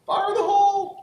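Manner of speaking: high, loud warning shout; grenade warning yell. Acted. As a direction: yell it out to warn everyone.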